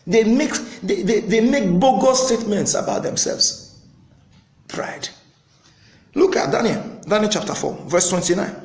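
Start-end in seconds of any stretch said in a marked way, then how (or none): 4.7-5.1 a hushed, unvoiced word; whisper. Real, not acted. Preacher to the congregation, whispering the word pride.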